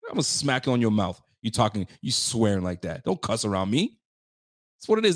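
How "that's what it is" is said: hyped up. Real, not acted. Excited outburst.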